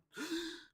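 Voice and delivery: high, sharp acted gasp; startled gasp. Dreamer shooting back up awake, startled gasp. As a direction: suck in air as if suddenly dropped. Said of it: "and I just went".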